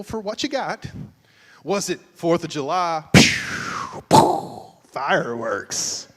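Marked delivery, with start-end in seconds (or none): 3.1-4.6 loud unvoiced pew pew; mock firework pews. Pastor describing fourth of July Valentine's, loud firework pew sounds.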